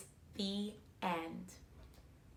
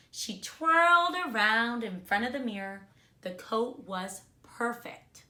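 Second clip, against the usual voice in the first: put-on reading a storybook to children; bright, expressive read-aloud; kindergarten teacher voice; 2 semitones higher, 4 dB louder than usual. A narrator's reading. Teacher reads picture book aloud.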